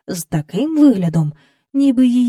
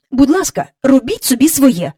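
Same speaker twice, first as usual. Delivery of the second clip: higher, louder nanny's reprimand; cross; 6 semitones higher, 5 dB louder than usual. A narrator's reading. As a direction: tell the children off crossly, raised voice. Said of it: "сердито сказала вона".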